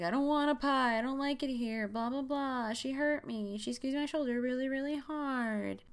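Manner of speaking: high, mock-whining kid complaint; whiny boy. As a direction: whine it like a put-upon child, dragging the end.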